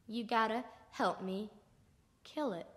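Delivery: very low, soft insistence; grim quiet plea. Acted. Man repeats his request to a reluctant friend, grim low plea. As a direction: press the request in a low hush.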